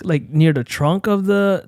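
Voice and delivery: ordinary delivery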